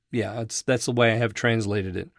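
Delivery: ordinary talk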